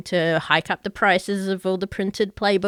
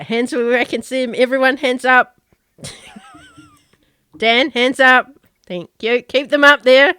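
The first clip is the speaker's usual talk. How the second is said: louder, higher stick-up commands; mock hold-up order; 4 semitones higher, 8 dB louder than usual. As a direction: playfully order a room to put their hands up.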